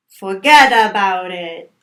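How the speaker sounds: put-on loud try at an Italian-American accent; attempted Italian-American accent. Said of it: "putting the emphasis somewhere different".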